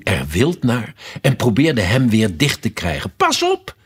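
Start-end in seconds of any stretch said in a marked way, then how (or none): 3.2-3.8 very high, urgent warning cry; calling out. A narrator's reading. Alice warns the queen about the brooch, crying out. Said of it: "riep Alice".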